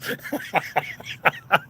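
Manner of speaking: long, high breathless laugh; hearty laugh. Real, not acted. Streamer reacting to being called dusty by chat, cracking up. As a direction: crack up helplessly.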